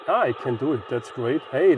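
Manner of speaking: ordinary delivery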